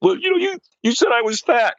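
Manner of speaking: high-pitched mock weeping; put-on tearful woman's squeal; imitated crying. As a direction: imitate a crying wife's wail, far up in pitch. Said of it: "Now she's crying her eyes out".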